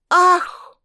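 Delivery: loud, high gasp of horror; horrified gasp. Acted. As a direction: gasp in sudden shock. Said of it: "произнесла Генка, открыв рот от ужаса".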